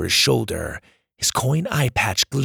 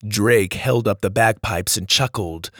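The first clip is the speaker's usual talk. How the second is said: ordinary delivery, nothing marked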